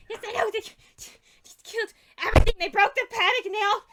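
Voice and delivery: high, loud wailing rant; worked-up little goblin, high and loud; distraught goblin. Acted. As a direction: blurt out the damage in a loud, high-pitched panic. Distraught goblin acted by player.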